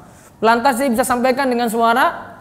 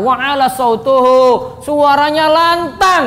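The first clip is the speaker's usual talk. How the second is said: loud, slow, ringing declamation; loud declaiming; 2 semitones higher, 4 dB louder, slower than usual. Shown as a demonstration. Teacher showing students how the Prophet preached, loud declaiming. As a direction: raise the voice and draw the words out.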